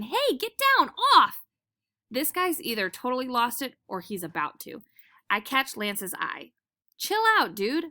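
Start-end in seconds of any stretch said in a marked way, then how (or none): 0.0-1.4 high, loud shout at a jumping dog. A narrator's reading. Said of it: "flailing his arms and shouting". 7.0-7.9 a high, light "chill out"; dog calming another dog, lightly voiced; mild calm-down. A narrator's reading. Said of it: "I tell him".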